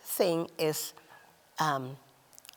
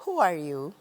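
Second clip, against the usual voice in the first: gentle who are you, voice kept down; 2 semitones lower than usual. Shown as a demonstration. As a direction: ask it kindly, at normal volume. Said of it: "Or you can say".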